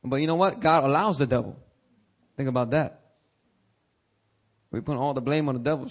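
ordinary talk

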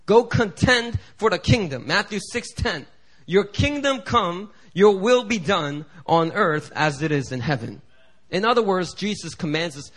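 loud, fervent sermon delivery; fervent preaching. Acted. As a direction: proclaim it to the congregation. Preaching on contending for kingdom.

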